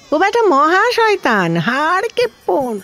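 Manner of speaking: high, swooping indignation; scornful denouncing. Acted. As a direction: run the manager down, pitch swooping high. Denouncing the stingy manager. (উঁচু সুরে গালমন্দ)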